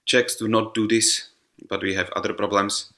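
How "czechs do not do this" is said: normal talking voice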